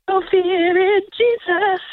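high, slow half-sung mock scream. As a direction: belt it like singing along in the car. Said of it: "I scream this from the car".

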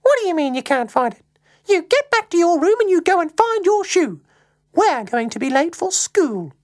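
high-pitched exasperated telling-off; scolding mum. Acted. Mum orders Luke to find his shoe, scolding.

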